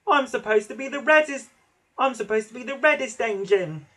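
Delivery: high, loud whining complaint; moaning. A narrator's reading. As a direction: moan with wounded pride. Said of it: "moaned James".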